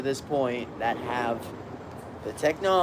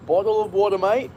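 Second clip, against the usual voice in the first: playful Australian accent, pitched a bit higher; 4 semitones higher, 4 dB louder than usual. Shown as a demonstration. Streamer offers chat water, mock Australian accent. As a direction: ask it like a cheeky Aussie mate. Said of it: "That sounds Australian, eh?".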